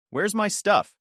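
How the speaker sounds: plain reading voice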